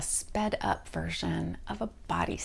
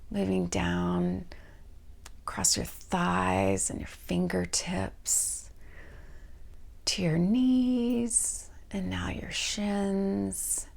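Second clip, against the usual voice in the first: guided meditation. Real, not acted. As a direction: lead them gently down the body, stretching each part's name. Leads a guided body scan.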